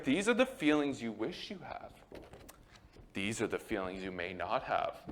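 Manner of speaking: soft mock counselor, dropping low; put-on gentle explanation, fading down; hammed-up counselor. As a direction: imitate a therapist growing hushed and low. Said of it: "I'm hamming it up a bit".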